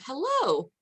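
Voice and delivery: ordinary delivery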